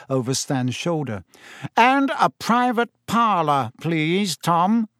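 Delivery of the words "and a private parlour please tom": higher, pointed stress to dismiss the others; pointedly. A narrator's reading. Fudge pointedly asks Tom for privacy. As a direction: make clear the hangers-on should go. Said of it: "said Fudge pointedly".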